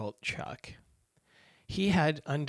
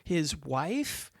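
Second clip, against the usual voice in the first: normal talking voice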